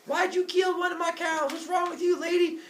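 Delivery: high, irate cartoon yell; hammed-up high-pitched outrage; mock yelling. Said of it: "starts yelling at the lady".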